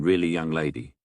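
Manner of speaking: ordinary delivery, nothing marked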